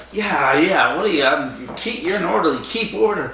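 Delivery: high, breezy mock dismissal; Brazen's cool brush-off. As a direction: wave them off like it's nothing. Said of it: "Brazen's great in this. He's like".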